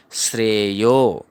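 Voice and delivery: श्रेयो said slowly, with the conjunct श्र plain like श्रे in श्रेय; teacher slowly sounding out श्रेयो; slow syllable demo. Shown as a demonstration. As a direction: spell out श्रेयो slowly, श्र clearly joined. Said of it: "क्या पढ़ेंगे?".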